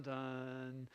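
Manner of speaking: ordinary talk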